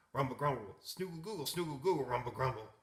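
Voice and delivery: loud, silly mixed snore sounds; a whole room of animals snoring; snoring chorus. A narrator's reading. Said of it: "began to snore".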